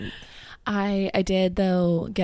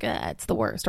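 deadpan gloomy voice; 14 semitones lower, 3 dB quieter than usual. Retold or imitated. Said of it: "Ben is gonna be like".

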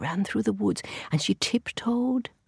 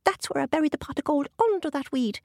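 leprechaun; 7 semitones higher than usual. A narrator's reading. Leprechaun reveals gold.

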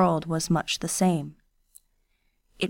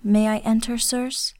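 put-on a lightly raised, shy young woman's voice; Kiyose, timid girl; 4 semitones higher than usual. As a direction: ask permission meekly, higher.

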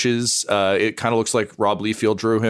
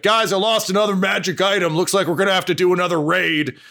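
troll calling out; 9 semitones higher, faster than usual. Acted. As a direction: call it out much higher and faster. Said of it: "he's like".

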